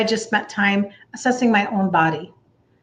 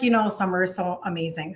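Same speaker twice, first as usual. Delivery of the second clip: ordinary talk, nothing marked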